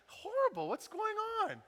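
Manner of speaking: high-pitched exasperated mock complaint; annoyed. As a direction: play up the outrage, voice shooting way up. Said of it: "You're just getting annoyed".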